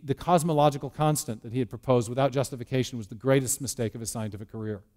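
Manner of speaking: ordinary delivery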